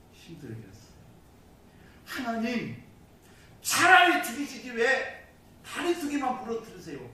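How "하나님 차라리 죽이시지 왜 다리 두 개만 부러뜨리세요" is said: high, loud grumbling at God; acted-out bitter complaint in prayer; resentful whining protest. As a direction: protest to God like a sulking child. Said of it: "하나님 앞에 원망하며 투정을 부리며".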